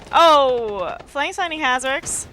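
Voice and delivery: loud high burst of excitement; excited shout. Real, not acted. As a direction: shout the Oh, then call it. Caster reacts to a kill.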